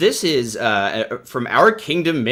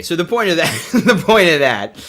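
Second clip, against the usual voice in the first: higher, louder, laughing through the words; 7 semitones higher, 8 dB louder than usual. Real, not acted. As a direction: crack up while trying to resume. Cracking up after the played clip.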